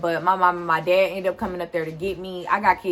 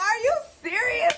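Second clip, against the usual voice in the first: much higher, incredulous "are you serious"; shocked disbelief. Retold or imitated. Teen being cuffed by the guard, high stunned disbelief.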